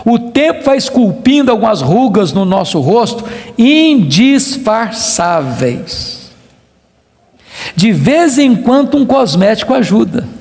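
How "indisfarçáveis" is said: "indisfarçáveis" stretched very slowly; drawn-out word. Acted. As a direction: linger on every syllable.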